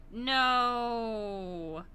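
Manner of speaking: normal talking voice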